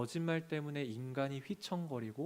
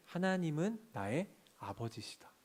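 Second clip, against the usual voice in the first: ordinary talk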